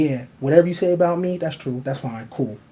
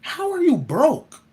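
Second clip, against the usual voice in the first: mock disbelief; 9 semitones higher, 3 dB louder, slower than usual. Mocking a childless complainer.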